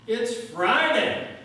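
very loud, high, slow climax shout; yelled at the top of his lungs. Retold or imitated. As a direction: hold each word, full-lunged. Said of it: "he just yelled at the top of his lungs".

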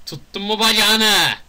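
very loud, high, drawn-out shout; excited yell. Real, not acted. Player yelling mid-action in the game, excited shout. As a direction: holler it slowly at full volume.

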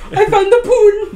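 high, loud, drawn-out and giggly; laughing outburst. Real, not acted. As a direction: crow it through laughter. Joking outburst while laughing.

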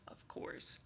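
knowing whisper; whispered aside. A narrator's reading. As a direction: whisper it to the listener. Narrator aside.

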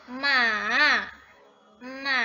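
ma loud, dipping; third tone ma. Shown as a demonstration. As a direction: dip ma as third tone. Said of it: "artinya kuda". (ma dengan nada ketiga)

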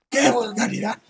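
shrill high scandalised shriek; imperious plummy grande dame. Pretend. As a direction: clutch your pearls, high and outraged. Repeats outrage, pitched far up.

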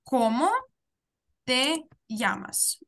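slow model reading, llamas starting with a Y sound; Cómo te llamas, slowly, ll pronounced as y; double L as Y. Shown as a demonstration. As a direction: say llamas as "yamas", spaced out for learners. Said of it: "яке ми читаємо як".

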